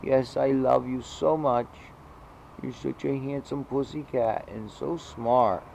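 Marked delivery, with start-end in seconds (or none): 0.0-3.0 pet talk. Real, not acted. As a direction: coo at him like a proud owner. Praising the cat in pet talk.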